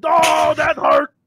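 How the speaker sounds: hammed-up hurt ogre; dim giant. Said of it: "I'm like".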